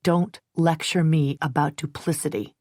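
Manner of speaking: usual speaking voice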